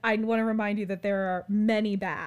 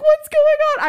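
much higher, louder question; hiding under a blanket yelling to roommate; panicked shriek; 14 dB louder than usual. Retold or imitated. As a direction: yelp it in alarm. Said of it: "I'm like".

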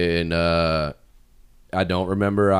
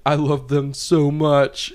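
slow, higher-pitched mock lament; mock crying; 5 semitones higher, slower than usual. Crying roommate confesses his love, mock weepy and drawn out. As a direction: draw it out as a pretend weepy confession. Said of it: "and he's crying".

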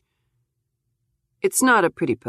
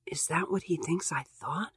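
whisper; 12 dB quieter than usual. Acted. Stung inner thought, whispered.

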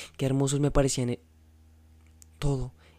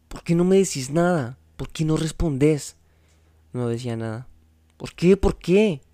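higher and louder, pleading demands; desperate shouting; 4 semitones higher, 6 dB louder than usual. A narrator's reading. Juan Pablo demanding María speak, shouting. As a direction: press her hard for an answer.

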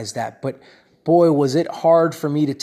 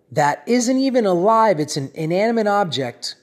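usual speaking voice

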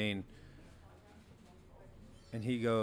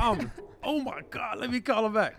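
much higher and louder, a flustered rush; retold excitement; 13 semitones higher, 6 dB louder than usual. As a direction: relive the panic of seeing the missed call. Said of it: "I got a little excited".

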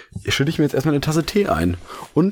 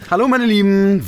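überdrehte Begrüßung; 9 semitones higher, 4 dB louder than usual. Acted. Begrüßung übertrieben nachgesprochen.

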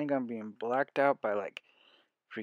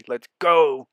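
excited shout; 2 semitones higher, 13 dB louder than usual. Real, not acted. Hyping team's hundred-year streak.

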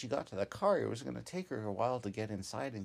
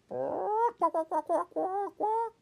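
high, loud imitated chicken squawking; chicken squawks; 10 dB louder, slower than usual. A narrator's reading. Chickens startled at night, squawking loud and high. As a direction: squawk like scared hens.

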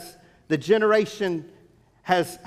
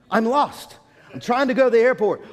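higher, hurried flustered plea; mock-frantic explanation, pitch raised; 5 semitones higher, 4 dB louder, faster than usual. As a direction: act out the lost, flustered driver. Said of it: "I'm flustered, and, and I'm mad".

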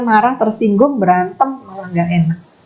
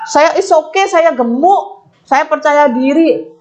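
a hint of smug pride, higher and slow; lightly voiced boast of a confident fat person; mock self-confident fat person; 7 semitones higher, slower than usual. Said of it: "Ngomongnya kalau saya di situ aja".

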